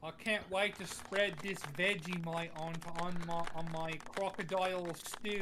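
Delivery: broad, badly faked Aussie accent; shitty Australian accent. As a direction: play up a cheesy Australian accent. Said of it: "somebody else has to do their shitty Australian accent".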